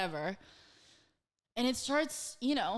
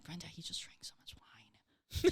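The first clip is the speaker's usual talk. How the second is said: hushed, unvoiced aside; retold whisper; 5 semitones lower, 6 dB quieter than usual. Storyteller confiding to roommate Brenda about the drunk guest, whispered.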